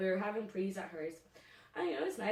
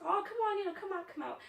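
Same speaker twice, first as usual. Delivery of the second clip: much higher, wheedling coax; lightly voiced pleading friend; 12 semitones higher, faster than usual. Lucy coaxing her friend out, high wheedling. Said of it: "Lucy was just like".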